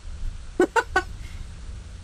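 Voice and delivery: snorting like a pig; unvoiced pig snort impression. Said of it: "That's my pig impression".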